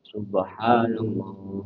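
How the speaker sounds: low, slow, drawn-out dhikr chant; hushed, lingering devotional recitation; dhikr intonation. Shown as a demonstration. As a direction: recite SUBHANALLAH low and unhurried, stretching the vowels. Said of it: "kalau kita zikir, gimana bacanya?".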